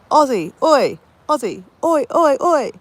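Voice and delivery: high chant, held short of a full yell; yelled-out cheer. Shown as a demonstration. Explaining the Aussie cheer for international games, chanting it. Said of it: "we'll yell out".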